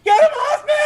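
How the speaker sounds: high, loud panicked shrieking; panicked farmer. Acted. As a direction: scream it in terror as beetles swarm. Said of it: "this farmer is like".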